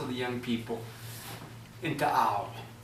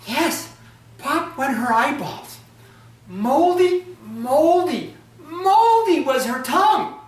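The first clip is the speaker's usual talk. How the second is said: high, loud singsong mockery of her moldy tongue; mocking taunt; 11 semitones higher, 6 dB louder, slower than usual. Hauti jeers to the young people, high mocking singsong.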